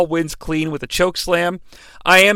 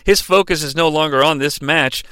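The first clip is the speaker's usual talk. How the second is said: ordinary talk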